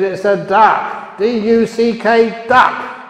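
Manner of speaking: husband losing patience, slow spelled-out yell; exasperated shout. Acted. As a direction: yell it letter by letter at your wife.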